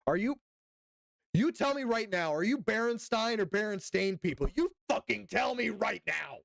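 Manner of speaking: you tell me right now, pressing hard; demanding shout. Real, not acted. Demanding an answer from chat.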